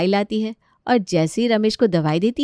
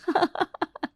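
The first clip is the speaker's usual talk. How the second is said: sly giggle; 10 semitones higher, 6 dB quieter than usual. Acted. Seema gloats alone.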